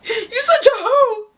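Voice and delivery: high, teary mock whine; mock crying. The crying boyfriend insulting the girlfriend, mock sobbing. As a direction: sob out the insult. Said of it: "he goes".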